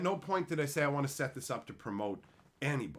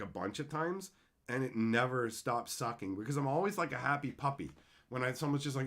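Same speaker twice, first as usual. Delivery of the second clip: normal talking voice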